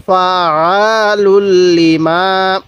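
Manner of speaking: each syllable stretched long; slow recitation. Shown as a demonstration. Teacher showing the joined reading slowly. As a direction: recite slowly with held sounds. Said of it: "কিভাবে পড়ব?".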